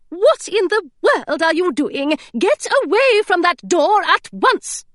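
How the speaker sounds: high, sharp governess reprimand; scolding. Acted. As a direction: order her away from the door at once.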